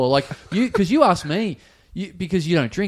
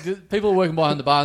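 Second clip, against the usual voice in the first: ordinary talk, nothing marked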